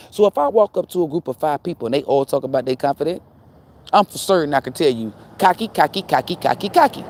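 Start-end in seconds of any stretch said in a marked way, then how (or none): 5.4-7.0 rapid taunting repetition. Acted. Acts out calling people cocky.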